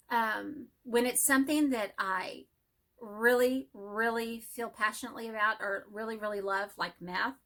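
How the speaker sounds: ordinary talk, nothing marked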